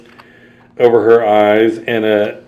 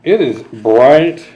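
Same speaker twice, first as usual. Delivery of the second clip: plain reading voice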